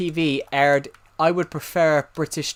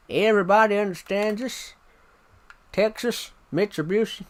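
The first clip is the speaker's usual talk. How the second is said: higher-pitched mock Texan accent; 5 semitones higher than usual. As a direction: put on a hammy Texas twang. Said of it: "what's wrong with my Texan accent".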